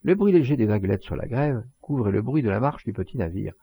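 ordinary delivery

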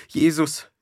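high, loud cry of mortal dread; frightened cry. A narrator's reading. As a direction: cry out expecting the death blow. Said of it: "rief sie". (angstvoller Ausruf)

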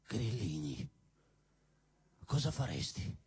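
gloomy dictator pleading; deep mock voice. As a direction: drop the voice into a dark growl. Deep low dictator groan.

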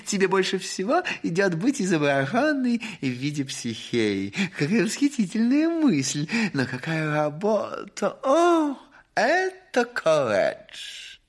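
high, excited gushing; gushing society lady. Acted. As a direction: rave with affected delight. Mother gushing over portrait.

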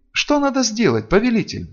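high, pleading question; mildly wretched, imploring voice; moaned plea. A narrator's reading. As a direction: moan it to a master. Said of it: "простонала несчастная".

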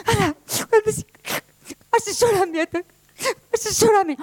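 high, crying plea; tearful pleading. Acted. Truth begging the Lie for a makeover, high sobbing. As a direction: beg through sobs. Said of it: "sorbiéndose los mocos".

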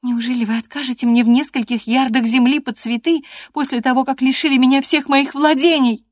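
high, loud protest; indignant cousin. A narrator's reading. Cousin answers the master for Gerton, in high indignation. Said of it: "за него ответила его кузина".

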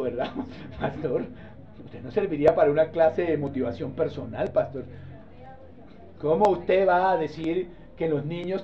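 low, quiet objection to the pastor; mildly protesting congregant; doubting church member. Acted. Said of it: "cualquiera dice".

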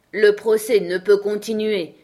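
loud, solemn royal declaration; very grave. A narrator's reading. King halting the trial before the court, very grave. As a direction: pronounce it with grave authority. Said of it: "d'un ton fort grave".

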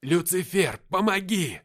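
high, loud plea for help; desperate cry. A narrator's reading. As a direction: cry out to a distant rescuer. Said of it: "мысленно закричал я".

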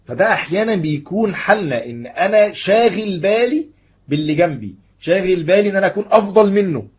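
high, loud, insistent sermon voice; emphatic preaching. Real, not acted. Preacher admitting we obsess over outdoing others, emphatic. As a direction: hammer the point home to the congregation. Preacher confesses a common failing with force.

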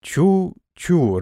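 distant echo of the cry. A narrator's reading. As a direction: let it ring back like an echo over water. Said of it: "разлетелось эхо".

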